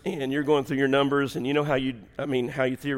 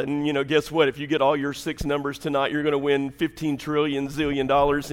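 normal talking voice

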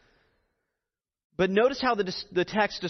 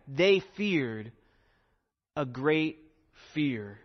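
"great" lifted, "fear" dropping low; very emphatic phrasing; 3 semitones lower, much slower than usual. Shown as a demonstration. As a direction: land hard on "great", let "fear" fall. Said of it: "So very emphatic".

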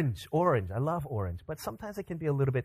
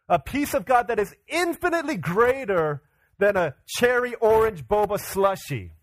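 rising preacher's cry, slowing on the key words; preaching at full force; 5 semitones higher than usual. Acted. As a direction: lift it into a passionate pulpit appeal. Preacher builds to appeal.

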